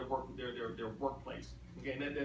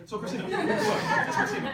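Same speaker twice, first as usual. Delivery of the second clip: loud high laugh through the name; real laughter; 12 semitones higher, 11 dB louder than usual. Real, not acted. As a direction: burst out laughing at finally getting it. Laughing at getting name right.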